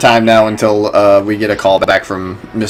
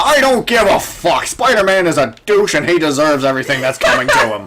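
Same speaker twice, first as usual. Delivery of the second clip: blustering editor rant pitched higher, ending in a laugh; J. Jonah Jameson impression; 5 semitones higher than usual. Mocking the editor who hates Spider-Man, mock furious rant. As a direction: spit it out like a furious tabloid boss. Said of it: "that is exactly how he would sound".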